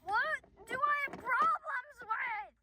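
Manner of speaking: high, loud, drawn-out indignation; mock angry outburst. Mock quarrel between acted dogs.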